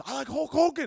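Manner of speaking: put-on high, loud, gleeful kid-like answer; eager childlike patient. Patient naming his favorite wrestler, eager childlike voice. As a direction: blurt it out like a big kid.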